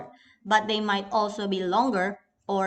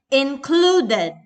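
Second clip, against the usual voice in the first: included with CLU louder and drawn out; stress on the second syllable; 3 semitones higher, 5 dB louder than usual. Shown as a demonstration.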